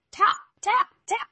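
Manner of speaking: high, loud rapping; mimicked loud knock on the door; knocking sound. A narrator's reading. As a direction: rap it out like knuckles on wood.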